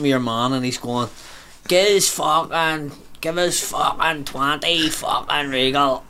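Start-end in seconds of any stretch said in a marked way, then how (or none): 1.7-6.0 put-on slow, slurred drunk ordering; drunk man in his fifties. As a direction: drag out every word like a drinker at the hatch. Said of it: "he fucking spoke like that there".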